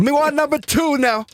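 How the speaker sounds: put-on high, childish broken English; mock broken ordering. Joking about ordering food, high mock customer voice. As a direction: play a clumsy customer ordering.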